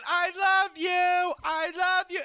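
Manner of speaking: high, drawn-out mock squeal; high-pitched slow adoring cry; screaming fan girl. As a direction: imitate an adoring fan calling out. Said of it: "that girl saying".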